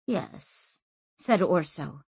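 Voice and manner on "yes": soft, sighed admission; sighing. A narrator's reading. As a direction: let it out on a sigh.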